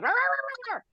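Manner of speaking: high, sharp little yaps imitating dogs; yappy dogs barking. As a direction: bark it like a pack of small yappy dogs. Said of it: "these other yappy dogs".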